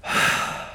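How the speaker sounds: long audible breath; unhurried breathy sigh; deep breath. Shown as a demonstration. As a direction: demonstrate a breath for the room to feel. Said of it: "That breath you just took, feel it".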